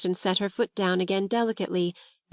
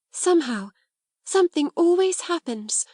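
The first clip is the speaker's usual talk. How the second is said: higher, rising into delight; wondering cry; 9 semitones higher than usual. Acted. Sarah marvels at magic in the attic, crying out. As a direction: marvel at sudden good fortune. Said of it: "she cried".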